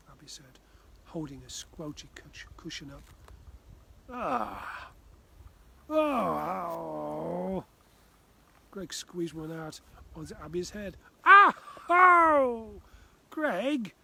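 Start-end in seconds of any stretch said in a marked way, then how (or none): 4.1-7.6 louder yelps of shock; startled yelps. Acted. Cold wet cushion squeezed. 11.2-13.9 much higher, much louder shriek of outrage; squealing at the soaking; shocked shout. Acted. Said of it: "shouted Abby".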